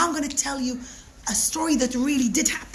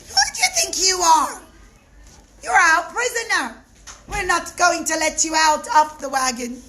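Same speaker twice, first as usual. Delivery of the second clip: higher, louder mocking guards; jeering shout; 5 semitones higher, 6 dB louder than usual. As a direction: bark down at the prisoner. Soldiers mock the prisoner.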